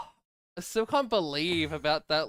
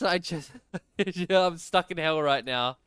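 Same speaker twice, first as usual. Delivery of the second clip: usual speaking voice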